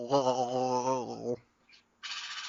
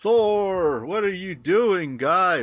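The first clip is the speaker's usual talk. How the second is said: high loud yell at teammate; exasperated yell; 6 semitones higher, 5 dB louder than usual. Real, not acted. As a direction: yell it in disbelief. Yelling at teammate.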